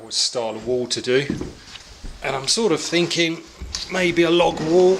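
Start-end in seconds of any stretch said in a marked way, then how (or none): 2.2-5.0 straining while lifting a log; effort grunt. Real, not acted. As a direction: talk while heaving something heavy. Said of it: "Excuse me, the grunting".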